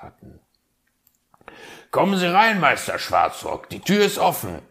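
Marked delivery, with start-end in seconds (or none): 1.9-4.6 high, loud call through the door; the fox calls his guest in, much higher and louder. Acted. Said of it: "rief der Fuchs".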